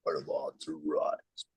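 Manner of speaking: deep robot voice. Acted. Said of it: "you sound like the two freaking robots".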